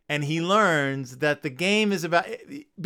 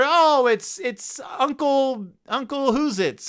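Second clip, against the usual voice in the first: higher, slowing fumbling reveal; higher voice, slowly fumbling the name; mock reveal; 9 semitones higher, slower than usual. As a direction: grope for the name in a put-on voice. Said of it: "if the mascot was like".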